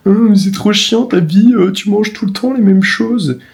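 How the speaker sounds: high, slow whiny mockery; mocking whiny critic. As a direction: mimic a whiny complainer.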